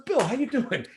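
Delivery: pretending to know Bill; A-Rod greeting. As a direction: toss it off casually. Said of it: "He goes".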